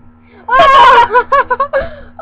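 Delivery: loud, high burst of real laughter; laughing shriek. Real, not acted. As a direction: crack up with a high, loud cackle. Cracks up at the joke.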